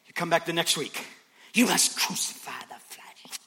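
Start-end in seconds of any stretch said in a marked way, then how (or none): 1.5-3.4 mock sermon. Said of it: "you hear a sermon".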